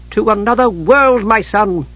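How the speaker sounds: high-pitched, eager promise. A narrator's reading. Mother luring her son to another world, eagerly. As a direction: lift the pitch with keen anticipation. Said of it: "Kitiara said eagerly".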